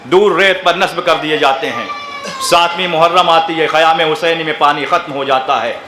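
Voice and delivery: mournful majlis narration. Acted. Preacher recounting Husain's journey to Karbala to mourners, lamenting. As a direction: let the grief settle into a hushed recital.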